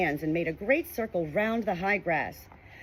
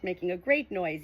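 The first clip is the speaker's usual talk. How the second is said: ordinary delivery, nothing marked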